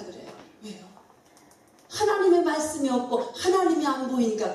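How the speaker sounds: ordinary delivery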